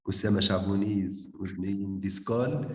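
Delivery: normal talking voice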